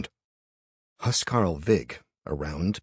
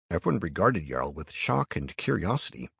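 plain reading voice

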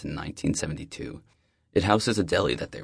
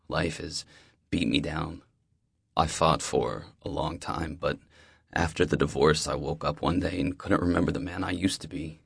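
ordinary delivery, nothing marked

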